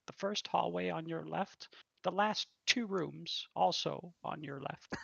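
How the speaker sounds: polite tavern waitress giving directions. Acted. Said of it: "She says".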